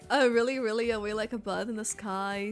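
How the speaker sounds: usual speaking voice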